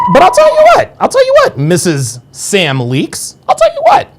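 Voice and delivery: sing-song taunt to the chatter; taunting falsetto. Acted.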